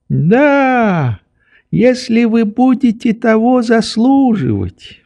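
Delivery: put-on high, sing-song old man's voice; prim old doctor. A narrator's reading. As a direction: agree on condition, in a high monotone. Old doctor answers Nelly conditionally.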